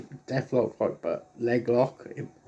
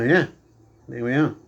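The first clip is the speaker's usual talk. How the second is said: ordinary delivery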